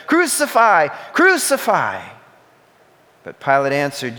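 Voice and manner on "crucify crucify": high, loud repeated shout. A narrator's reading. Chief priests shouting to Pilate, loud high demand. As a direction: hurl it like a demand. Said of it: "they shouted".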